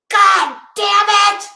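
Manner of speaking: loud, high, slow curse; angry outburst. Retold or imitated. Alone in the kitchen, cursing in a loud high burst. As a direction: let it rip at the cereal. In kitchen, finding wrong cereal.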